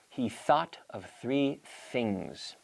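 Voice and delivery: "He thought of three things" very slow, each th voiceless; slow th drill. Shown as a demonstration. Teacher modelling a voiceless th sentence slowly for practice. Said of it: "Try that".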